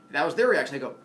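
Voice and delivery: usual speaking voice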